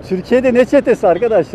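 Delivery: loud, high, incredulous; loud disbelief. Real, not acted. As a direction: shout it in disbelief. Retorts at gang claim loudly.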